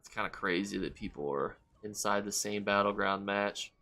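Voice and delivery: usual speaking voice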